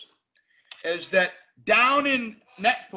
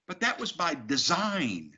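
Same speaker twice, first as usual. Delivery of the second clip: ordinary talk, nothing marked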